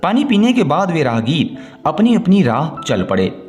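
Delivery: ordinary delivery, nothing marked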